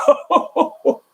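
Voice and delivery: high giggle breaking in; giggling over the words. A narrator's reading. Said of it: "He giggled".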